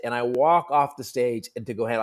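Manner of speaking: ordinary talk, nothing marked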